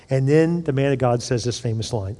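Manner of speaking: ordinary talk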